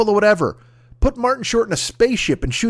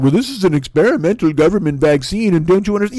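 whiny conspiracy voice; know-it-all doubter; mock skeptic. Mocking skeptic quote.

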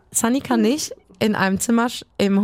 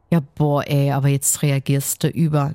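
lower, dismissive mock voice; mocking others; 8 semitones lower than usual. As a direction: imitate a condescending bystander, lower. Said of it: "Und dann heißt es von wegen". (spöttisch nachgeäfft, tiefer)

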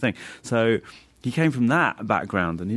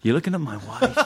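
mock jealous; 7 semitones higher than usual. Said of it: "he'd go".

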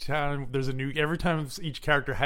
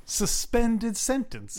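high, slow, drawn-out quote; imitated film character; 7 semitones higher, much slower than usual. Quoting the father on the judge's ruling, high slow imitation. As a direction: stretch each word in mock dismay. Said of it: "repeat that line the way he does".

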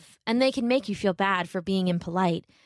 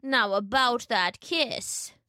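slow, creaky fake grandmother; a wolf feigning a sick old lady; wolf posing as granny; 3 semitones higher, much slower than usual. Wolf tricking Little Red, faking a sick granny.

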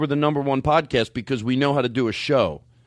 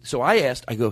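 usual speaking voice

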